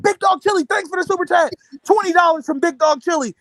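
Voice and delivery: high mock streamer voice; Fanon. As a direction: announce a donation like an eager host. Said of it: "He said".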